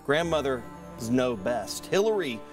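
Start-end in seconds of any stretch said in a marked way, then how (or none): none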